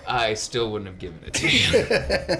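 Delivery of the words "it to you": laughing refusal. Real, not acted.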